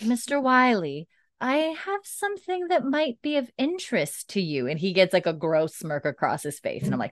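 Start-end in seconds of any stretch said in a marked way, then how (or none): 0.0-4.7 acted Claire. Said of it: "And then Claire's like".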